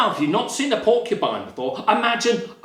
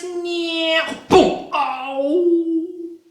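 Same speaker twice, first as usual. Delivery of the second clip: high squeal, loud boom, drawn-out ow; mimed crash landing; 10 semitones higher, 3 dB louder, much slower than usual. A narrator's reading. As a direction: act out a tumble with noises. Acting out the bunny's fall.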